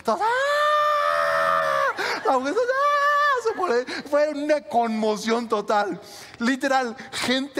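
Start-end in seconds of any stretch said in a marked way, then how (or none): 0.2-2.0 long high-pitched imitated shriek; mock crowd scream. Pastor mimics passengers at the proposal, mock scream. 2.6-2.9 high-pitched imitated squeal; mock woman's scream. Pastor plays the woman being proposed to, mock scream.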